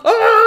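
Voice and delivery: high, loud imitated scream; mock scream. Friend comes running back from the knife, mock terrified scream. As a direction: yelp like a kid fleeing, hammed-up.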